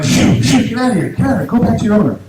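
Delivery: high-pitched shooing; acted-out scolding at stray dogs; shooing dogs away. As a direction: shoo them off urgently.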